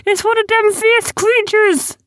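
very high, loud terrified squeal; spooked. A narrator's reading. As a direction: squeal a panicked warning. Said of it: "suddenly got spooked".

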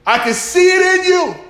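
sung-out high preaching line; soaring high on see; high preaching climb. Acted. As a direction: lift it high and hold it like a whoop. Preaching builds on repeated line.

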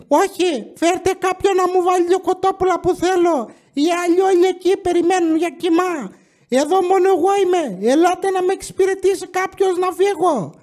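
high, put-on old lady voice; imitating grandma. Grandma demanding chicken at the counter, high mock voice. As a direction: squawk it like a demanding granny.

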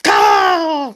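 very loud, high agonized scream; scream of pain. Acted. Adam as God pulls out his rib, agonized scream. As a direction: scream out in sudden agony. Adam's rib being removed.

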